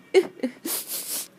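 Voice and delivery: breathy acted sniffling sob; sad snuffling. Bee sadly stuck by the stream, snuffling. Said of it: "He snuffled".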